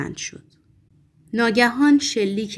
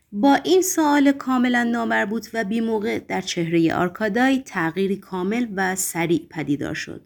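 usual speaking voice

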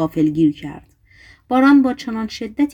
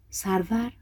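softer, a dying murmured question; faint whisper; 7 dB quieter, slower than usual. A narrator's reading. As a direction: breathe the word as a last question. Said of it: "به زمزمه گفت".

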